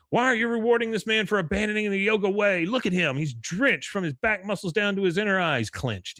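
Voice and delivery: high, outraged mock yogi; indignant yogi. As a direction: protest high like an offended guru.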